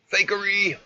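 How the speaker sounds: high, loud mock outburst; exclaiming. Real, not acted. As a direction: belt out the word Fakery!. Streamer calling the video fake.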